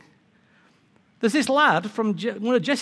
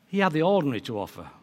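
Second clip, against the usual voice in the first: ordinary talk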